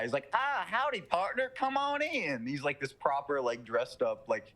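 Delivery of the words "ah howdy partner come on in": put-on old Southern guy. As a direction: greet him like a Southern gentleman. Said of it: "old Southern guy".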